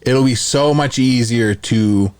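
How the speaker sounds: usual speaking voice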